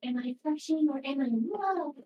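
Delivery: high, loud mock lovestruck question; over-dramatized. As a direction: play up the romantic dilemma like a melodrama heroine. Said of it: "way over dramatized and weird".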